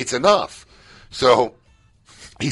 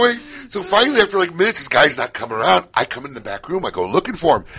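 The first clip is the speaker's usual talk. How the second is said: ordinary talk, nothing marked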